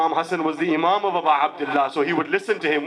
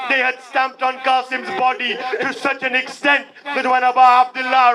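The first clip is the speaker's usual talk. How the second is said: higher, louder grieving preacher's cry pressing forward; impassioned lament; 6 semitones higher, 5 dB louder than usual. Acted. Preacher to mourners telling of Qasim's death, crying out in loud lament. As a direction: pour out the lament at full force to move the mourners.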